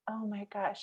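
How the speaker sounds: hushed tender surprise; gentle realization. Retold or imitated. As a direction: let it dawn quietly and kindly. Teacher's gentle reply after the tirade.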